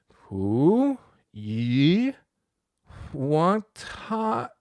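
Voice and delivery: "Pu Yi Huang Tai" dragged out, climbing high; laboured, unnatural pupil's "Pu Yi Huang Tai"; singsong wrong tones. Acted. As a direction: chant "Pu Yi Huang Tai" one stretched syllable at a time. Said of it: "There's no bouncing ball".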